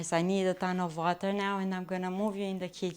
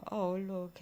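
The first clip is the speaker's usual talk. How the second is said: ordinary delivery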